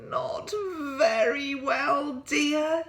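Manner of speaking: slow, loud yawned reply; yawning. Acted. Mister Bear tired after a sleepless night, yawning. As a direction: yawn through the words. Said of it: "yawned Mister Bear".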